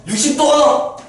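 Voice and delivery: teacher suddenly getting carried away; sudden excitement. Real, not acted. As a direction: blurt it out all fired up. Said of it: "또 급발진해".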